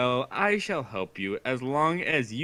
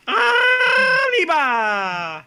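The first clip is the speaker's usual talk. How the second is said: shout; 7 dB louder, much slower than usual. Acted. Shouted sound effect cheer.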